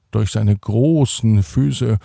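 ordinary delivery, nothing marked

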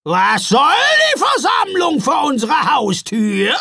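high, loud troll matriarch; suspicious troll mother. A narrator's reading. Troll mother confronting the gathering at her door, loud and suspicious. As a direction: demand it loudly, full of distrust. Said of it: "fragte sie misstrauisch".

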